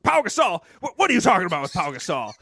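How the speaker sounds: put-on loud, incredulous old coach; coach impression. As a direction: bark the name back in disbelief. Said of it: "He's like".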